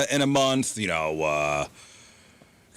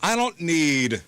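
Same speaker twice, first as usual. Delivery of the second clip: slow, drawn-out mock retiree; old people style; much slower than usual. Describing his ideal retirement in a slow, drawn-out mock-old voice. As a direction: stretch every word like a contented old retiree. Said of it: "the old people style".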